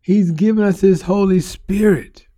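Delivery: normal talking voice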